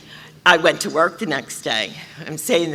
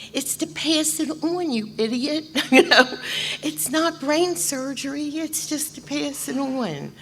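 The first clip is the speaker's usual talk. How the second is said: scolding inner voice; 10 semitones higher, 3 dB quieter than usual. Retold or imitated.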